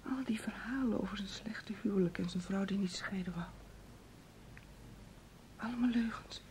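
hushed, shattered whisper; a broken whisper to herself; devastated whisper. Acted. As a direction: whisper it as the truth sinks in. Realising his lies, devastated.

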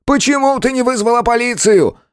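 high, strained furious boss; angry yelling boss. A narrator's reading. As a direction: tear into her angrily. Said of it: "Гневно надрывался".

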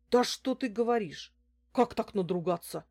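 startled man's anxious questions; frightened man. A narrator's reading. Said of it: "зачастил испуганный мужчина". (испуганно, встревоженно)

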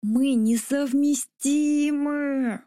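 slow, high, strained growl. A narrator's reading. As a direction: growl it right in her face. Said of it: "прорычал Тик мне в лицо".

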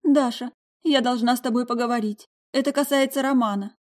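shaky voice. A narrator's reading. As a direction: fake a trembling, upset voice. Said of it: "дрожащим голосом произнесла".

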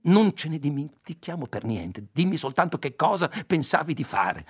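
a woman furious at her companion; angry outburst. A narrator's reading. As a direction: press him hard for the truth. Ayla furiously confronts Roby.

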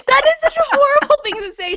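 laughing in disbelief; shocked laugh through the words. Real, not acted.

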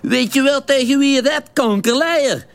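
high, loud furious Venlo thug; scene: thug exploding at the cabbie in the hospital hall, high and loud; exploding in anger. Retold or imitated. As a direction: blow up at him in coarse Venlo dialect. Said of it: "toen ontplofte die".

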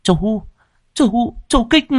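high pleading call. A narrator's reading. Wife cries out to her brother-in-law for help, high. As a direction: appeal to him desperately.

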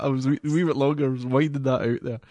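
fast, laughing explanation. Real, not acted. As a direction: laugh through the excuse.

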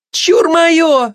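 very high, greedy shout; barked claim. A narrator's reading. Glutton snatches meat from the gossip, roaring. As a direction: roar it out before anyone grabs it. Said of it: "рявкнула обжора".

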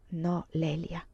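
a grave, sorrowful denial; sad and solemn. A narrator's reading. Priest answers the dying Lelia, sad and solemn. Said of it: "con voce triste e solenne".

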